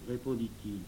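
usual speaking voice

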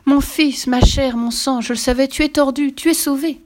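high, loud exclamations of relief; overjoyed father. A narrator's reading. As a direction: burst out with joy at your child. Father overjoyed, son is finally twisted. (père fou de joie, voix forte et aiguë)

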